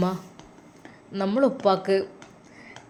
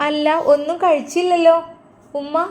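daughter calling out; 8 semitones higher, 5 dB louder than usual. Acted.

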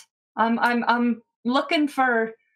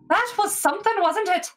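higher, a touch shaken; a frightened halfling trying to make light of it; 9 semitones higher than usual. Acted. GM voices Scylla.

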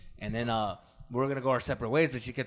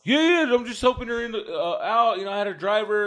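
nervous excuse; 8 semitones higher, 4 dB louder than usual. Retold or imitated.

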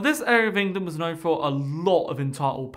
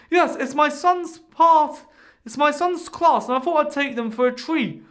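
smug mother; 8 semitones higher, 5 dB louder than usual. Retold or imitated. Mother proudly explains.

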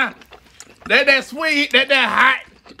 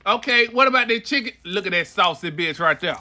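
ordinary delivery

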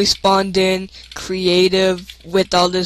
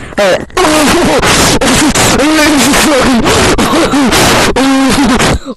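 shrieking laugh; 5 semitones higher, 5 dB louder than usual. Real, not acted. Shrieking laugh after a find.

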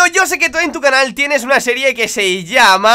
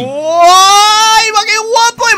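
shouting over wind; 11 semitones higher, 7 dB louder, much slower than usual. Real, not acted. Salto espectacular en el juego.

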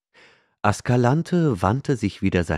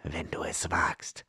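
menacing whisper; 9 dB quieter than usual. A narrator's reading. Outlaw taunts the slave.

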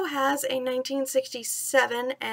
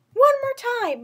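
put-on much higher, louder boyish cheer; 11 semitones higher, 6 dB louder than usual. Mowgli cheering to dance again, loud high cheer. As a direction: shout it out with glee. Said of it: "Mowgli cheered".

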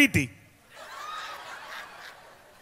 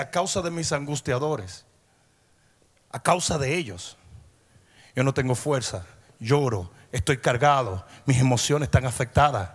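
much softer and lower, heavy with grief; quiet lament; 10 semitones lower, 5 dB quieter than usual. Acted. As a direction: sink into David's sorrow, barely above a murmur. Lamento de David, voz baja. (lamento suave y grave de David)

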